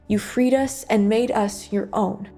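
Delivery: ordinary talk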